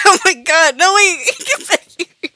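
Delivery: high, loud, half-laughing shout; frantic calling after. Real, not acted. Gamer chasing escaping prey, frantic laughing shout. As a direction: call out frantically through a laugh.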